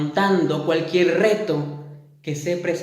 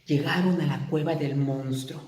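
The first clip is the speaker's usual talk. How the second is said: ordinary delivery, nothing marked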